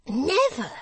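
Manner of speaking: high, loud, emphatic Barnaby; cried out. Acted. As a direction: fling the word out, high and loud. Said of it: "cried Barnaby".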